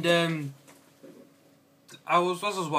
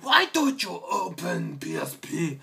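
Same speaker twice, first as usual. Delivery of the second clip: high, drawn-out exasperated wail; hammed-up frustrated cry; mock frustration; 4 semitones higher than usual. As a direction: plead slowly with a stubborn gadget. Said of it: "so I was really frustrated".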